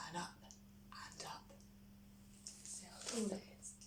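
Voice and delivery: hushed, fast aside; whisper. Real, not acted. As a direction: whisper it to the listener. Reader whispers to child.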